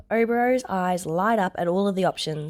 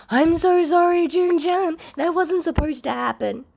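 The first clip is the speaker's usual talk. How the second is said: high, loud, tumbling rush of sorry; flustered apology, words spilling out; babbling apology; 10 semitones higher, 4 dB louder than usual. A narrator's reading. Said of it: "already babbling".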